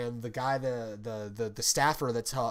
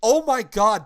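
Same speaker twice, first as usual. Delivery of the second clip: loud startled gasp, higher; startled oh my God; 7 semitones higher, 12 dB louder, slower than usual. Retold or imitated. Retelling walking in on strangers, loud startled exclamation. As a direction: blurt it out caught off guard. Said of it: "you hear me go".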